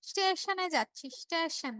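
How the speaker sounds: slow Dinajpur "স্টেশন" said as shteshon; Dinajpur accent. Retold or imitated. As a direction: imitate Dinajpur speech, sh opening "স্টেশন". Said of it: "ওই দিনাজপুরে বলে". (দিনাজপুরের টানে "শ্টেশন")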